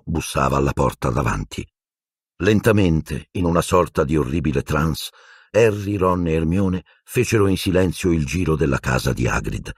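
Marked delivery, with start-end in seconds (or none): none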